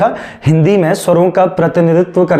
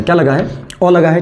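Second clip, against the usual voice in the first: ordinary delivery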